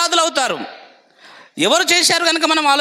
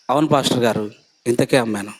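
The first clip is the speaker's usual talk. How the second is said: lower, meek innocent answer; lying reply; faster than usual. Acted. Wife lying to the apostle, low and meek. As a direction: say it low as if nothing is wrong. Sapphira repeats the lie.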